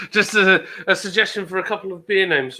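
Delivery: shrieking laugh then laughing words. Real, not acted. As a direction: burst out laughing, talk through it. Bursts out laughing at comment.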